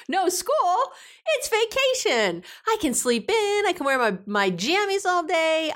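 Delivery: put-on high giddy child voice; excited kid voice. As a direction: gush like a kid on holiday. Voicing her excited kids.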